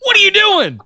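high-pitched angry rant; exasperated yelling. Real, not acted. Co-host ranting at the boss over made-up rules, yelling. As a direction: yell at him to stop talking. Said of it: "I'm yelling a lot tonight".

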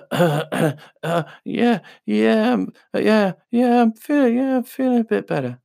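imitating a boy forcing a stronger voice. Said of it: "strong voice".

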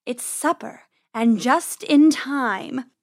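slow, relishing predator; drawn-out, menacing purr; purring cat. Acted. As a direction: savour it like a cat finding prey. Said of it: "she purred".

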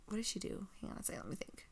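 whispering to herself. Real, not acted. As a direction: mutter it under your breath while thinking. Thinking aloud to herself.

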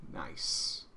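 very soft, breathed aside; sly whisper. Real, not acted. Streamer's aside on his crude joke, whispered.

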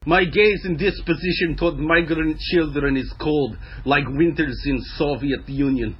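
deadpan Eastern European chill; Eastern European accent. Acted. First lady on migrant children, cold Eastern European accent. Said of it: "that Eastern European cruelty".

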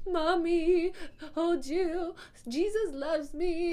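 put-on high, slow little-kid plea; mother voicing her toddler girl, high and slow. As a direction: plead sweetly like a sleepy toddler.

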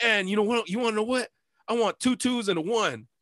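high, smug insistence; cocky demand. Retold or imitated. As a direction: gloat while making demands.

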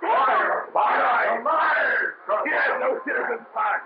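high shouted accusations from the mob; crowd shouting. Acted. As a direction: yell it out across the street.

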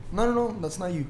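mock-casual bouncer dismissal; nonchalant. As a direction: toss it off without caring. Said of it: "Like, nonchalant".

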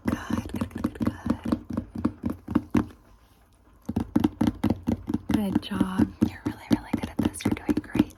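ASMR whisper. Acted. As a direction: whisper praise close and gentle.

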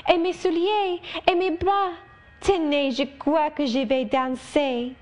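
bubbling over, about to dance; excited little girl. Acted. Adele showing Rochester her shoes, thrilled and high. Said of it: "cried she".

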